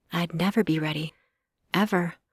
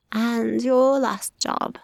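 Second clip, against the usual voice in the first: mock sympathy; 6 semitones higher, 4 dB louder, much slower than usual. A narrator's reading. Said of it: "in mock sympathy".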